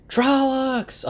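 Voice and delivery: high-pitched imitated alarm shout; mock shout. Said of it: "a bunch of voices shout".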